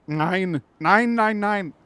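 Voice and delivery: loud, high comic German protest; mock German accent. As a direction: bark repeated German refusals in mock outrage. Mock German "nein" after accent apology.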